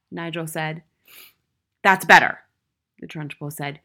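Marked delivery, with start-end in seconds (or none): 1.8-2.4 loud, stern interrogation; domineering schoolmistress; tyrannical headmistress, demanding. A narrator's reading. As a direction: demand it sharply of the boy.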